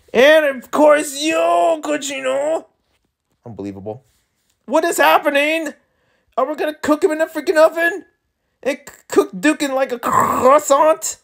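high-pitched squeal of disbelief; outraged reaction. Real, not acted. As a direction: squawk it, scandalised. Reader reacts in outrage to scene.

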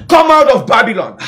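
high, urgent preacher's summons; shout. Acted. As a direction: call out each command in rhythmic bursts. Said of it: "the shout is a voice".

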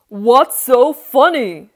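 very loud, put-on royal demand; indignant king. King confronts laughing boy, loud indignant demand. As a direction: boom it out at the giggler.

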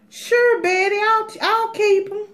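put-on very high, loud sugary accent; sweet high mock Southern yes. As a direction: squeal it like an eager neighbor. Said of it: "I just love to do accents".

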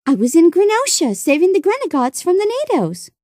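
put-on high, eager young girl's voice; excited girl explaining. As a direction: pitch climbing high as she insists. Girl excitedly explains her adventure.

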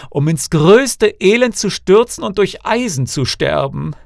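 high, loud cry of despair; anguished wailing lament. A narrator's reading. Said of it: "rang die Hände und rief aus".

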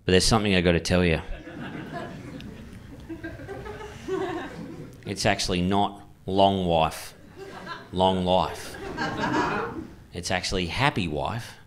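lightly voiced dad making a sincere speech; heartfelt dad. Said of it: "And he said".

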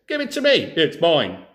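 high, loud snapping retort; squabbling. Acted. One thief argues with the other over the chicken, angry shout. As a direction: grab it back and shout him down.

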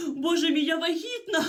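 woman finding out she is pregnant, overwhelmed; tearful shock. Acted. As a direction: gasp it out half crying.

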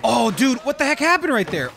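loud startled disbelief; surprised outburst. Real, not acted. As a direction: react loud to a sudden mistake. Game mishap, shocked outburst.

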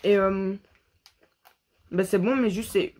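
snack stick at mouth; speaking between bites; talking while chewing. Real, not acted.

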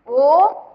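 ओ held long and loud; prolonged ओ. Shown as a demonstration. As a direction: sustain ओ like a call. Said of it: "इस तरीके से". (ओ को ज़ोर से देर तक खींचना)

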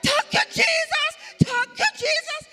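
full-force, high-pitched ecstatic shout; screaming hallelujahs in joyful shock; bawling praise. Retold or imitated. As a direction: bawl it out slowly at the top of your voice. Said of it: "she started to bawl".

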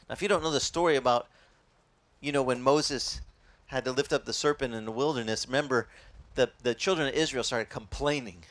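usual speaking voice

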